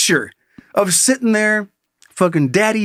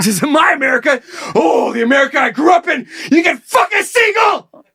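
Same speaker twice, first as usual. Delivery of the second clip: high shouted mock indignation, climbing higher; outraged rant; 8 semitones higher than usual. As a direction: build to a hammed-up outraged scream. Acting outraged man's rant.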